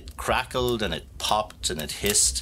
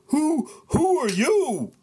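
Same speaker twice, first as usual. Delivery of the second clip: high, slow, terrified cry; frightened stammer; 4 dB louder, slower than usual. Acted. Farmer O'Horrible woken by the ogre, terrified stammer. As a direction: squeak it out in a fright. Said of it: "jumped up with such a fright".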